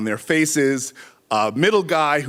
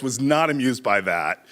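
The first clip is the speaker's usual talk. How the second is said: ordinary talk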